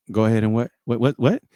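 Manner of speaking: ordinary delivery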